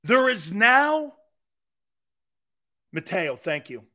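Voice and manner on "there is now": high, loud and drawn-out, NOW stretched out; emphatic repeat. Real, not acted. Outraged fan repeating the bad news, slow and emphatic. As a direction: hammer "There is now" slowly for outrage. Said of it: "I'll say it again".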